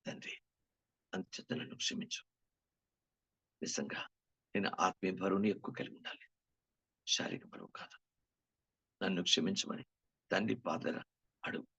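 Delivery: soft earnest prayer to the Father. Acted. Preacher praying aloud to the Father before the congregation, softly. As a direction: pray it humbly, half aloud.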